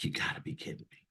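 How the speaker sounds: quick, whispered disbelief; barely voiced, stunned reaction; hushed disbelief. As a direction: whisper it fast, as if stunned by news. Stunned at news mother is dying.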